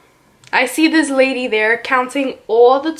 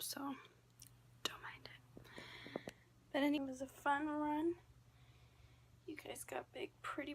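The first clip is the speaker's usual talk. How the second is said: whisper; 3 semitones higher, 24 dB quieter than usual. Real, not acted. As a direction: hush it like a secret goodbye message. Secret whispered video message to daughters.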